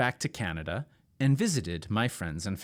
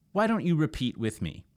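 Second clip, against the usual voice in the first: ordinary talk, nothing marked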